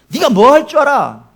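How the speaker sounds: loud jeering voice of the world; mocking accuser. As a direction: taunt them like a scornful critic. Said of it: "이런 바람이죠".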